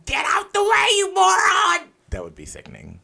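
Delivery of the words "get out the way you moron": very high, piercing shouted impression; Gilbert Gottfried. Host imagines being old with comedian's voice, shouted impression. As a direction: yell it as a cranky sixty-five-year-old with that voice. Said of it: "Imagine me, sixty-five years old".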